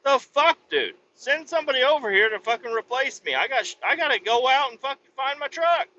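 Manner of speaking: high, fast, exasperated rant; angry outburst. Retold or imitated. Ranting at his boss who won't replace him, in high exasperation. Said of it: "I'm like".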